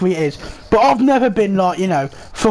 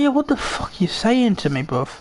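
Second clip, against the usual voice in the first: indignant; 3 dB quieter than usual. Retold or imitated. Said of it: "I was like".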